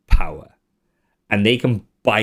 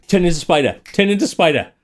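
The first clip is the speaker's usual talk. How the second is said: lightly voiced wizard; 6 semitones higher, faster than usual. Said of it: "You go".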